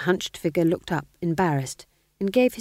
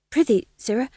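higher, eager jester patter; jester fool; 7 semitones higher, 5 dB louder than usual. Acted. Fool replying to the Duke in jester talk, higher. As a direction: chirp it like a court fool.

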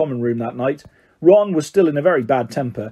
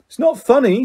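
higher, fierce retort; 8 semitones higher than usual. A narrator's reading. Ron to a giggling Hermione, fiercely. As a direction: snap back with mild fierceness. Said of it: "said Ron fiercely".

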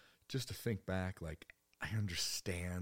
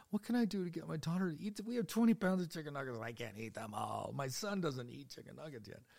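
put-on pleading young parent; 9 semitones higher than usual.

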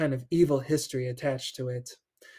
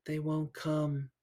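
softer, slow ghostly murmur; barely there, like from beside you; faint voice; 7 dB quieter, slower than usual. Acted. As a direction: ghost in the locker speaking faintly. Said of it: "a faint voice said".

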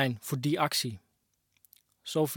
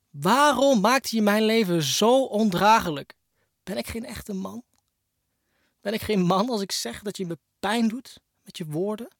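hurt pleading; 6 semitones higher than usual. Acted. Wounded man asking his ex why, pleading and higher. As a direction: ask why as if it still stings.

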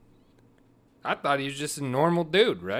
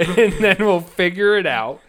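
laughing; 7 semitones higher, 8 dB louder than usual. Real, not acted. Laughing while joking about lawsuits.

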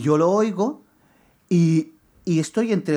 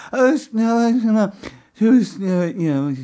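raised, louder stumbling non-answers; stammer, searching for words that won't come; flustered stammer; 7 semitones higher, 5 dB louder, slower than usual. Retold or imitated. To his father, stammering helplessly with no answer. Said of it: "como no sabía qué decir, le decía".

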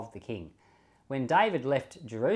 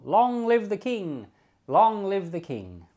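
slow, high, ringing hail to the king; a friend hailing the new king, slow and ringing; royal acclamation; 7 semitones higher, 6 dB louder, slower than usual. Acted. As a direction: proclaim it like a herald.

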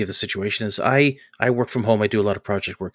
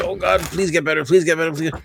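higher, half-joking plea to the heavens; mock pleading prayer; 7 semitones higher than usual. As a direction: beg the body to heal, hammed-up. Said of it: "Willing, I'm like".

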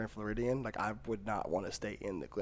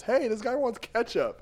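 much higher, louder call across the room; server calling out; 11 semitones higher, 6 dB louder than usual. Retold or imitated. Server announcing the ketchup request, loud high call. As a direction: announce it to every table. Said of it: "He goes".

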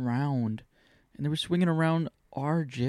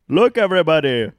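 much higher, loud hammed-up Indian accent; mock Indian accent; 6 semitones higher, 10 dB louder than usual. Showing off to everyone in a mock Indian accent. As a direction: shout it out in a jokey Indian accent. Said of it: "Indian out".

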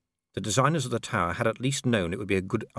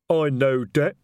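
louder, slow, heavy troll voice; 5 semitones higher, 7 dB louder, slower than usual. A narrator's reading. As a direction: speak like a slow-witted troll. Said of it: "said detritus".

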